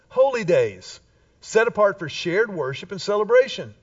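ordinary delivery

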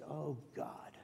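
a quiet plea for forgiveness; under my breath. Retold or imitated. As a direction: pray it softly, almost to yourself. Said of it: "under my breath".

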